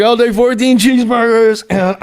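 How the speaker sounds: high, steady comic order; Chris Farley. Retold or imitated. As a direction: lightly imitate a customer reeling off food. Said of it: "when they filmed it, he's like".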